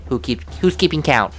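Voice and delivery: ordinary talk, nothing marked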